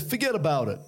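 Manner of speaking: playful New York impression; New York accent. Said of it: "New York?".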